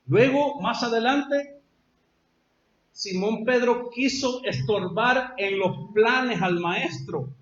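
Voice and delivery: usual speaking voice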